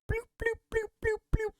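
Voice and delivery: very high squeaky bloops; cartoon bubble noises. Narrator voicing a water attack as high squeaky bloops.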